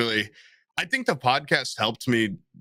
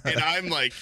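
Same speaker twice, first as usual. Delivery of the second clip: ordinary talk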